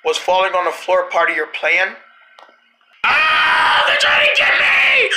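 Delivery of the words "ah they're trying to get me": panicked scream. Acted. The gang chases him, so he shrieks in panic. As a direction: shriek as if they're grabbing you.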